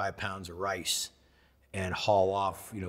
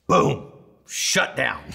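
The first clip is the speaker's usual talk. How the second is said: loud, punched exclamation; dramatic storyteller's burst; explosive boom; 3 semitones higher, 8 dB louder, much slower than usual. Retold or imitated. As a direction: hit it like a sudden blast. Retelling website crashing.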